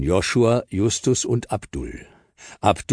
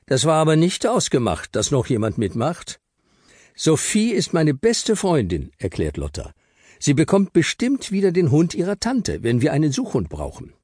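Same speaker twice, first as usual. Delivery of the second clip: put-on higher kid voices, a touch of squabble; children arguing; 9 semitones higher than usual. Abdul and Lotta argue about Sophie, lightly voiced. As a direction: kids bickering over a new member.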